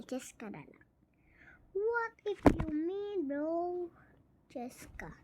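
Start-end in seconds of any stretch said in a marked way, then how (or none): 0.0-0.9 softer whispered aside. Real, not acted. Gamer settling on a name, whispering. 4.5-5.1 much softer whispered name; just breathe the name. Real, not acted. Gamer murmuring the name, whispering.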